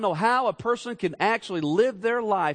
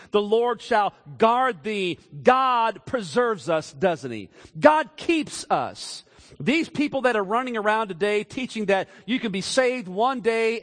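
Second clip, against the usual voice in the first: loud, high, climbing preacher's cry; full-throated revival preaching; preaching at full force; 5 semitones higher, 3 dB louder than usual. Acted. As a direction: lift it up and preach it hard.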